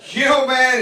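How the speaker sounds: usual speaking voice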